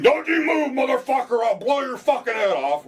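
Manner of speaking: high, loud imitated cop scream; mock police yell. Lawyer imitating a raiding cop, mock yell. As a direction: bark it like a raiding cop, in mockery. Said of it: "yell and scream".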